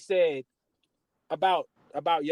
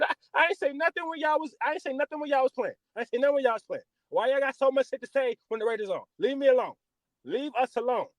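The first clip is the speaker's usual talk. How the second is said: higher, fast exasperated comeback; indignant retort; 9 semitones higher, faster than usual. Retold or imitated. Raiders fan snapping back at family, higher and fast. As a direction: fire back, fed up with them.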